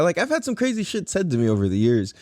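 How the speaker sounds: ordinary talk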